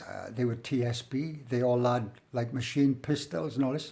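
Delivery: ordinary delivery, nothing marked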